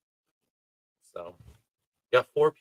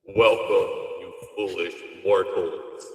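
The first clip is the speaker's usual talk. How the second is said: low, slow spooky villain voice; booming demon lord; 4 semitones lower, slower than usual. Shown as a demonstration. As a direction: greet them like a haunted house host. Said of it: "Watch, watch, watch. Ready?".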